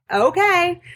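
high-pitched acted "okay"; retold going along. Patient to endocrinologist about the plan, going along, high-pitched.

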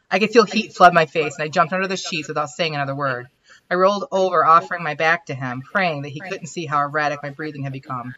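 reading her romance story aloud at an even pace; the author reads her own chapter to the writing group; story narration. Acted. Story narration read aloud.

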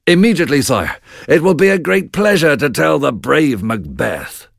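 lightly voiced nobleman, keen to obey; eager lord. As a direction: glad to carry good news. Ross answers the king.

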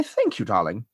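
RP British thanks; posh, theatrical British; posh British accent. Acted. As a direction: accept praise in posh British RP. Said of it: "Very posh".

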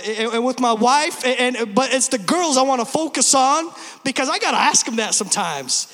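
ordinary talk

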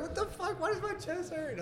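high, panicked squeal with a laugh; scared. Retold or imitated. Retelling his own high scare from an edible. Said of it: "scared, too".

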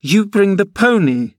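put-on very high, girlish command; exclaimed. A narrator's reading. Girl turning to the woman, indignant order. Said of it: "she exclaimed".

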